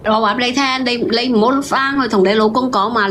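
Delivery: ordinary delivery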